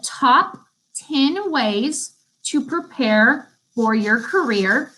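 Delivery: slow, careful dictation. Shown as a demonstration. Teacher dictating a note title to students, slow and careful. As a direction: spell out the title slowly for note-takers. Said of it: "I'm gonna repeat the title".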